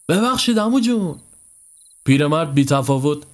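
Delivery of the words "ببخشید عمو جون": high-pitched polite call. Acted. Friend hailing an old villager on the road, calling out. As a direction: call to a stranger, voice pitched well up. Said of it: "پیمان با صدای بلند گفت".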